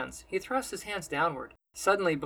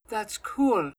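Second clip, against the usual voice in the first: impressed; 6 semitones higher, 5 dB louder than usual. A narrator's reading. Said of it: "Almir lets out".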